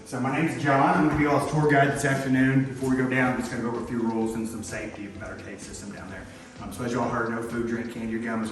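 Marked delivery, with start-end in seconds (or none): 0.0-3.3 projecting voice. Real, not acted. Guide addressing tour group.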